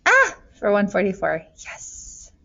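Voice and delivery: ordinary talk, nothing marked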